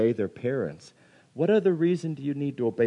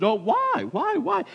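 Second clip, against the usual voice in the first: put-on high, repeated kid's pestering; questioning kid; slower than usual. Kids' favorite word, high pestering imitation. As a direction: imitate a nagging child. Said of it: "the question kids always ask".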